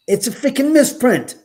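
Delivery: high, loud exasperated protest; incredulous retelling. Retold or imitated. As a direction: tell dad he's crazy. Arguing with dad over listing.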